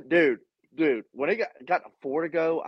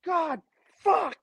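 frustrated curse; 12 semitones higher than usual. Retold or imitated. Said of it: "I was like".

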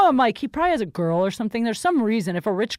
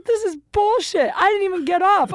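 retold angry outburst; 9 semitones higher, 4 dB louder than usual. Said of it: "I was so pissed".

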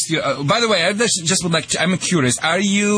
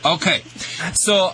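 ordinary talk, nothing marked

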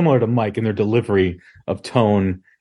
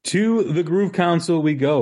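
announcer intro; 7 semitones higher than usual. Acted. Show segment intro announcement.

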